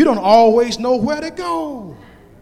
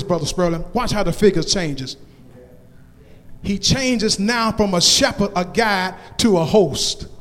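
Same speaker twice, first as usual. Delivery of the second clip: normal talking voice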